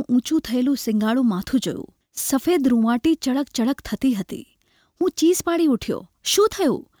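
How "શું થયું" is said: high, alarmed question; trembling voice. A narrator's reading. Friend reacting to the narrator's scream, trembling voice. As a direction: ask it in a trembling, higher voice. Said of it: "એણે કાંપતા અવાજે કહ્યું".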